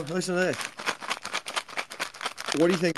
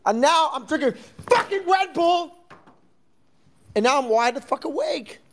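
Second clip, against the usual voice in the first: wired rant; 11 semitones higher than usual. Real, not acted. Ranting about drinking Red Bull.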